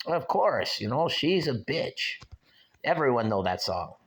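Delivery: a hint of a Mexican fan's broken English; Mexican accent. Imitating a Mexican fan recognising him in Walmart, light Mexican accent. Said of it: "He could barely speak English".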